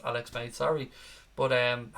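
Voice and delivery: usual speaking voice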